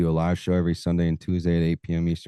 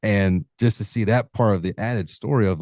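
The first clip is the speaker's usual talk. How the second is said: ordinary talk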